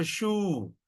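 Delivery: shoe with a long, drawn-out OO; pulled vowel. Shown as a demonstration. Teacher explains the dash on top, pulled-vowel demo. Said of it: "you pull the word".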